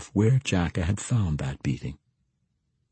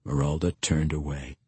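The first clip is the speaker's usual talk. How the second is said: ordinary delivery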